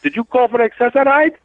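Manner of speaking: hint of a puzzled lady asking; lady with a cane. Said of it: "She goes".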